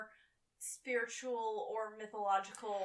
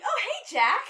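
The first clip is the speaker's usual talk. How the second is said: put-on high, loud, bubbly greeting like an eager kid; imitating a giddy, childlike hello; childlike and excited; 11 semitones higher, 13 dB louder than usual. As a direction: burst out with childlike glee. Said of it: "really childlike and excited".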